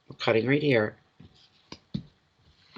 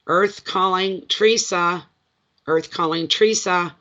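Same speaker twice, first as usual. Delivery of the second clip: playful calling out; 6 semitones higher than usual. Pretend. Hailing a missing listener.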